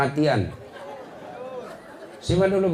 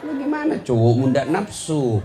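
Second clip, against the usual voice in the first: ordinary talk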